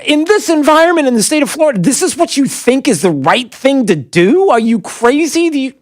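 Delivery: fast, high-pitched outrage; worked up. Real, not acted. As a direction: rush it out, incensed. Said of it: "I'm, I'm worked up".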